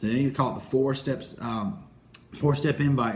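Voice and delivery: ordinary talk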